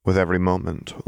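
usual speaking voice

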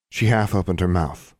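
ordinary delivery

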